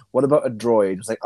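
ordinary talk, nothing marked

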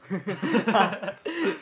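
lautes Lachen. Real, not acted. Lachanfall nach Versprecher.

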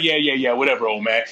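low, offhand mock brush-off; dismissive. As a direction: wave the old man off, voice dropping low. Girl brushes off her dad's warning.

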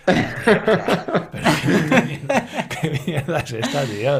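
high, loud laughter through the words; laughing. Real, not acted. Host laughing in disbelief at being teased. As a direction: laugh while protesting.

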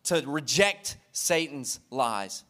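spoken slowly, word by word; slow deliberate restatement; slow emphatic repeat. Shown as a demonstration. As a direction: lay each word down slowly for the congregation. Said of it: "I don't want you to miss that first word".

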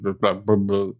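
low, slurred mumble; demonstrating a mumble. Shown as a demonstration. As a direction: keep your mouth half closed and slur it. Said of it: "Like, I mumble a lot more".

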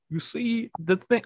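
high, drawn-out professor impression; tentative attempt at a high-voiced lecturer; Jordan Peterson. Shown as a demonstration. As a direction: stretch it out in a high, strained voice. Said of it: "I do a really good Jordan Peterson".

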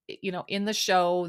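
ordinary talk, nothing marked

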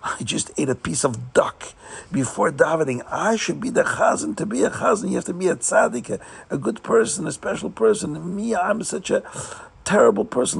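a humble man unworthy to lead prayer; distraught chassid. Acted. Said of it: "he thinks to himself".

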